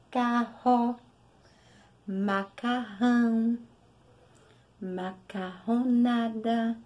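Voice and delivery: carro, macarrão, macarronada drawn out with a throaty rr; slow strong rr. Shown as a demonstration. Teacher slowly models double-r words for children. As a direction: stretch each word and lean on the rr. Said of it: "Tá saindo som forte".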